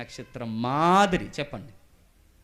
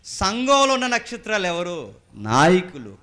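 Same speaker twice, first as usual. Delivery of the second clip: preaching call; 4 semitones higher, 10 dB louder, slower than usual. Real, not acted. Preacher calls out to congregation.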